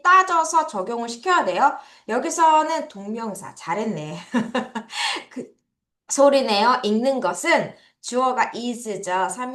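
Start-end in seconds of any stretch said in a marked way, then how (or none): 4.2-5.2 short, real chuckle; brief amused chuckle; soft laugh. Real, not acted. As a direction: laugh lightly to yourself. Teacher laughs at own remark.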